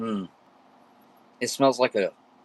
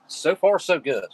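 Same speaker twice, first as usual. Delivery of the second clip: upbeat; 8 semitones higher, 7 dB louder, slower than usual. Real, not acted. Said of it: "I will say".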